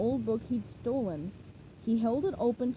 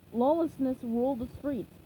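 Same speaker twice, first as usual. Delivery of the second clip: ordinary delivery, nothing marked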